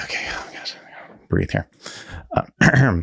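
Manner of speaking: normal talking voice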